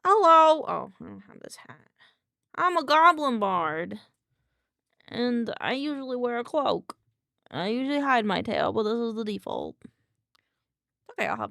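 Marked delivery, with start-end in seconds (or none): 0.1-0.6 loud, high greeting as Biff the goblin. Acted. Streamer greets chat as her goblin bard, loud. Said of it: "I'd be Biff for a little bit". 2.6-8.0 goblin Biff. Acted. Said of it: "I'd be Biff for a little bit".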